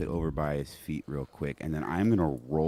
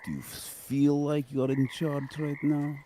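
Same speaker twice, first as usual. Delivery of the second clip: higher-pitched taunting merc voice; merc's pointed, pitched-up challenge; in-character merc; 7 semitones higher than usual. Acted. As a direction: needle the bandit in character. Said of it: "And I'm going to say".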